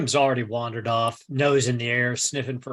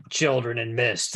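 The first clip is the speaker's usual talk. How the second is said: ordinary talk, nothing marked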